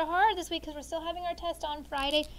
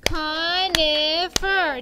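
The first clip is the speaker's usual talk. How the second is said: louder, very slow CON-i-fer in beats; slow syllable count; 6 dB louder, much slower than usual. Shown as a demonstration. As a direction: stretch Conifer into three claps. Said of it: "Ready, begin".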